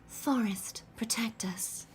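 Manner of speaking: breathy, delicate voice sinking into a whisper. Shown as a demonstration. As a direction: lift it slightly, airy and small. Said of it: "a bit more Cardathia pitch".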